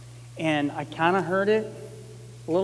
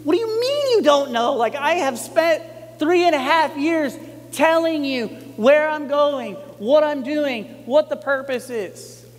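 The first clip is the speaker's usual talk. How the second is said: imagined frustration; 8 semitones higher than usual. Retold or imitated. Jesus exasperated at disciples who still don't understand, frustrated protest. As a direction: let the frustration spill over after years of explaining. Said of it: "I can imagine Jesus' frustration".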